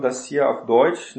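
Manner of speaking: ordinary talk, nothing marked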